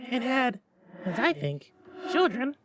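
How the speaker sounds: very high, otherworldly spirit; ghost voice. A narrator's reading. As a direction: float it like an eerie spirit. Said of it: "said the ghost".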